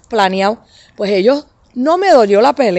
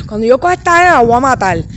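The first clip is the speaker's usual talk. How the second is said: mock furious threat; 3 semitones higher, 3 dB louder, faster than usual. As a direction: raise the pitch like you'll really get her. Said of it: "nosotros los boricuas decimos así". (amenaza airada de madre boricua, medio en broma)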